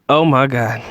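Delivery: a squealing laugh of disbelief after oh my God; cracking up in shock. Real, not acted. Host cracking up at a blunt answer, squealing laugh.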